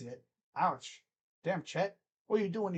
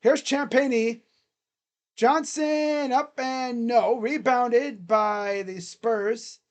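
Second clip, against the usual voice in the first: slow, stretched announcer call; sustained sportscaster delivery, words held long; play-by-play commentary; 4 semitones higher, 5 dB louder, much slower than usual. Acted. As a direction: draw out each call like a commentator. Live play-by-play.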